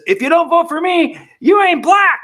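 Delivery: hammed-up Biden impression; mock Biden. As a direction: shriek it like a cartoon Biden. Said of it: "Joe Biden says".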